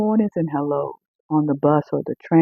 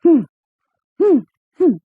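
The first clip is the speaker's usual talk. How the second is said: put-on high childish humming; imitating a child; 8 semitones higher than usual. Preacher imitating a toddler humming without words to the congregation. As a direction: hum high like a wordless toddler. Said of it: "You get a child and they're going".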